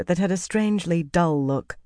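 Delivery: ordinary delivery, nothing marked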